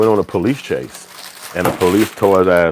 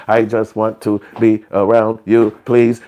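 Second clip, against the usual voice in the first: slow, louder mock zombie begging; zombie-like pleading; 3 semitones higher, 5 dB louder, slower than usual. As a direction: beg word by word like a zombie. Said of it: "the old zombie shit".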